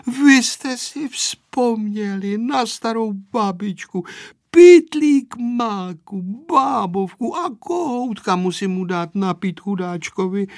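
high, slow, emotional granny; doting old woman, drawn-out and high; tearfully moved grandmother. A narrator's reading. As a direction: count the gifts with tender delight. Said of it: "řekla něžně babička a slzy jí tekly".

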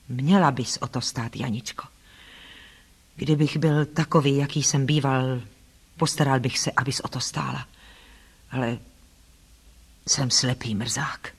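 low man's voice, sinking into gloom; despondent Rochester. Acted. Blind man regrets what he can no longer offer, despondent. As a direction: play a bitter, resigned invalid, lowering the voice.

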